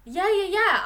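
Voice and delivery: high, loud rapid yeahs; excited agreement. Real, not acted. Hearing her co-host's smart take, excited high yeahs. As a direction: burst in delighted. Struck by co-host's new idea.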